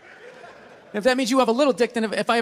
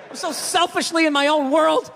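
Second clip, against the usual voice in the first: usual speaking voice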